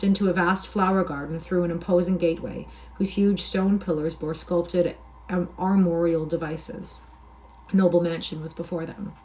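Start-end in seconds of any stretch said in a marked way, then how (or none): none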